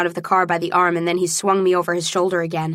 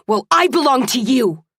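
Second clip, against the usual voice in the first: heated, higher-pitched outburst; shouted; 5 semitones higher, 4 dB louder than usual. A narrator's reading. Man leaning into her face, shouting. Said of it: "as he shouted".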